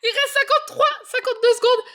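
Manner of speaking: high laughing cheer; triumphant whoop. Real, not acted. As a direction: shout it in victory. Triumph at finishing puzzle.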